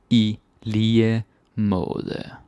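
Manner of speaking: "I lige måde" stretched slowly, every sound clear; slow teaching repeat. Shown as a demonstration.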